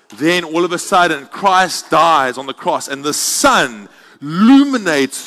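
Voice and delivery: loud, high, soaring preacher climax; preaching at full force. Acted. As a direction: lift the whole room with the vision.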